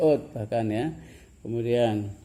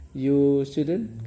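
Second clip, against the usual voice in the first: "You student" slow and louder, rising on "student"; question intonation; 2 semitones higher, 5 dB louder, much slower than usual. Shown as a demonstration. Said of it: "ucapannya seperti bertanya".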